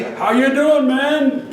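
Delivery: loud, high, exuberant imitation; hearty greeting. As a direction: greet him like an overjoyed big guy. Big brother grabs him in greeting.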